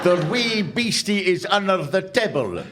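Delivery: broad Ulster Scots, wee stressed; mock phrasebook Ulster Scots; Ulster Scots reading. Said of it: "Ulster Scots into English".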